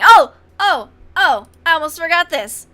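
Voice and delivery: loud startled oh, three times; a sudden loud remembering gasp; sudden realization. Real, not acted. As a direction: blurt it out as it hits you.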